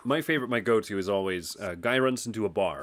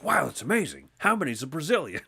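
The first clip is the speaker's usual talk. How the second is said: high-pitched wide-eyed awe; hammed-up amazement, pitched up for the punchline; innocently wowed; mock amazed; 5 semitones higher than usual. Acting president in a joke.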